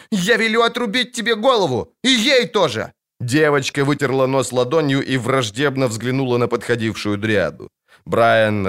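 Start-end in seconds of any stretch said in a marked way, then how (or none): 0.1-2.9 high-pitched imperious threat; shrill out a little princess's tantrum; yelling child. A narrator's reading. Princess threatens witcher and dryad, yelling. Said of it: "хватит вопить".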